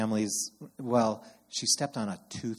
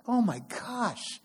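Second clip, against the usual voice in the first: shocked; 10 semitones higher, much slower than usual. Retold or imitated.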